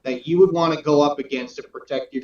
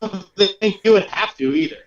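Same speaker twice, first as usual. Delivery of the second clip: talking through a laugh, higher; 6 semitones higher than usual. Real, not acted. Guest agrees nobody would force Murph's hand, laughing. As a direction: laugh your way into the reply. Laughs at being called scary.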